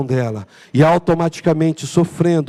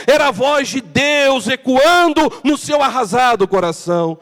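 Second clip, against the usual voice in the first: ordinary delivery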